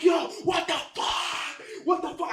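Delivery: strained, drawn-out imitated shout; panicked retold outcry; shocked mock yell. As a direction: act out yelling in shock after a crash. Acted shock after the crash.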